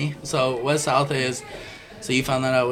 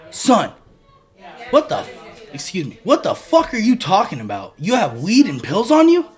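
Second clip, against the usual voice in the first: raised, incredulous mock scolding; retold angry dad; 5 semitones higher, 5 dB louder than usual. Dad on one knee confronting son, indignant retelling. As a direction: imitate a dad's disbelief, higher and punchy.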